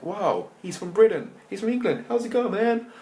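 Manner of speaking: high, loud awed American voice; mock amazed American onlookers; impressed American gangsters. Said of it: "so that they're like".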